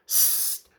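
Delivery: sharp unvoiced cat hiss.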